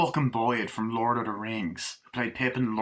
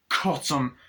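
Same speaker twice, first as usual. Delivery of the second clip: ordinary talk, nothing marked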